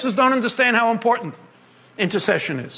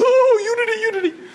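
much higher, gushing swoon over unity; dreamy churchgoer; 11 semitones higher than usual. Retold or imitated. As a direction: gush like a starry-eyed believer. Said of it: "everybody is googly-eyed and their mind goes mush".

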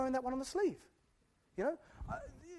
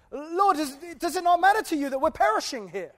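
louder, higher, mock desperate plea; pleading disciples; 6 semitones higher, 12 dB louder than usual. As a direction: cry out over the storm, lightly. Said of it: "They said".

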